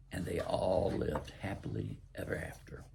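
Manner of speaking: hushed storybook close; soft whispered fairy tale finish; whispered ending. Acted. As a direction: breathe the final line softly.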